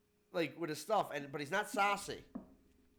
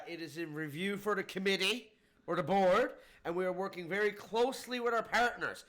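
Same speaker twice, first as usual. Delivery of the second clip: lightly voiced official, pitched up; mock official line; 5 semitones higher, 5 dB louder than usual. Mimicking an evasive official's favorite line to a friend, mock official. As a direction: recite it like a rehearsed stock answer. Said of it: "That, that's the line".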